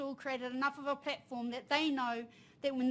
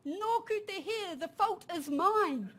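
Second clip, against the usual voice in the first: put-on higher, slow child voice confessing the fault; guilty little son; 6 semitones higher, slower than usual. Young son telling his mother it is his fault, slow and sad. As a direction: let a small boy own up, slowly and sadly. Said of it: "He goes".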